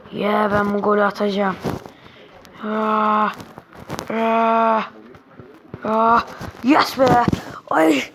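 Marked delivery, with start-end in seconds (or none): none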